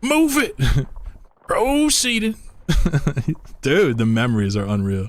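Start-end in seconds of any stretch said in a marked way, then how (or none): 0.0-0.6 very high-pitched tank driver; game unit voice. Acted. Streamer imitating the siege tank, very high voice. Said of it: "practice my StarCraft voice lines". 1.5-2.4 very high-pitched tank driver; game unit voice. Acted. Streamer imitating the siege tank, very high voice. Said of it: "practice my StarCraft voice lines".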